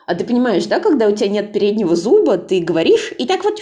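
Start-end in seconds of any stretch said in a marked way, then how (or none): none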